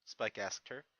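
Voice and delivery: ordinary delivery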